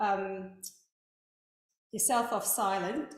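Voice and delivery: normal talking voice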